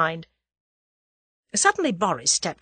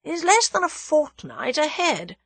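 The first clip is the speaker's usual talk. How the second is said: higher-pitched, measured musing; thoughtful; 10 semitones higher than usual. A narrator's reading. Captive weighs the date aloud, thoughtful. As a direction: let the implication dawn. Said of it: "he said thoughtfully".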